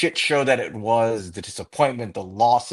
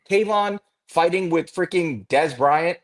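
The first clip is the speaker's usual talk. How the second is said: ordinary talk, nothing marked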